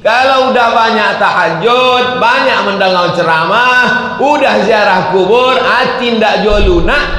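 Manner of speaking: high, loud exhortation; forceful preaching. Real, not acted. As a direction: drive each clause out to the congregation.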